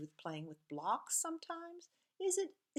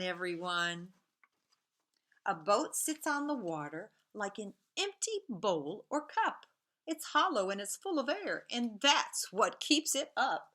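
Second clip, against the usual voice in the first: lively picture-book read-aloud, a bit louder than her chat; clear, bouncy storybook reading; reading to children; 6 dB louder than usual. Acted. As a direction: read the story to a class of young kids. Teacher reads Busy Boats aloud.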